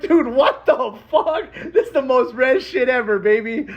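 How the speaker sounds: high, drawn-out laughing amazement; delighted high-pitched astonishment; laughing disbelief. Retold or imitated. Amazed, laughing retold reaction.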